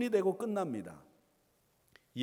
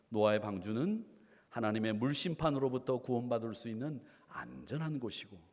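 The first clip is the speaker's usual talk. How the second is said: usual speaking voice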